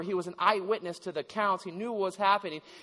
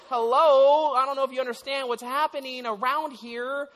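sarcastic; 6 semitones higher, 5 dB louder than usual. Retold or imitated. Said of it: "you know, like".

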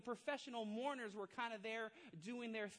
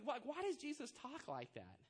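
ordinary talk

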